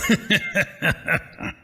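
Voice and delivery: gleeful breathy snicker; sly snake laugh. Acted. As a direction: laugh like the trick worked. Snake laughs after lying.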